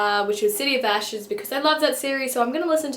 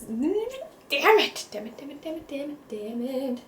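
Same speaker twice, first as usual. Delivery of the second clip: frustrated muttering; 4 semitones higher, 4 dB quieter than usual. Real, not acted. Frustrated at forgetting a name.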